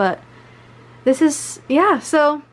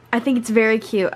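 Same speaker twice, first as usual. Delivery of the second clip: ordinary delivery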